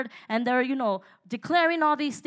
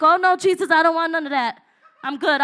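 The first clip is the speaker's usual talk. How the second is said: alarmed plea; 3 semitones higher, 7 dB louder than usual. Retold or imitated. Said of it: "I was like".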